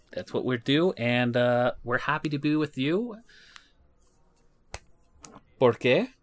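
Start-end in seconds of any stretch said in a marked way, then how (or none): 5.6-6.1 Spanish setup line. Pretend. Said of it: "that means because, it's the setup".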